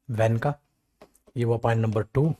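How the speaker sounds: usual speaking voice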